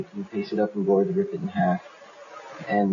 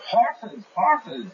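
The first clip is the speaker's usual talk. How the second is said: thrilled brigands; 12 semitones higher than usual. Acted. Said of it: "And they say".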